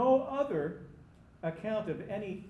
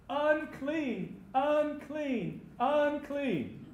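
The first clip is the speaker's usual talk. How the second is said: high, drawn-out warning cry; three ringing, high-pitched cries; 9 semitones higher, 4 dB louder, much slower than usual. Acted. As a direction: call it out as a leper warning passers-by. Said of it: "they would have to cry out".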